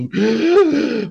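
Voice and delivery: loud hammed-up gasp of fright; mock horrified gasp. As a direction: gasp as if terrified, for sarcasm. Mock horror at free speech.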